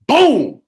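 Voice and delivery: loud high "Boom!" mimicking a punch; punch sound. Guest reenacts uncle punching the man, loud mock boom. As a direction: blast out the impact noise.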